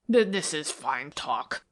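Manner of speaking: slow, faltering lightly voiced fear; stammering. A narrator's reading. Fenwick, threatened by Zary, stammers back nervously. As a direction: stumble over the first word, uneasy. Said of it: "Fenwick stammered".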